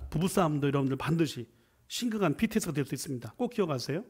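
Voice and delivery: usual speaking voice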